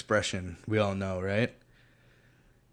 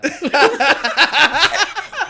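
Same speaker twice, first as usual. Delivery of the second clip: high, loud, helpless laughter through the words; cracking up; 12 semitones higher, 9 dB louder, slower than usual. Real, not acted. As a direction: gasp out praise while laughing hard. Cracking up at friend's joke.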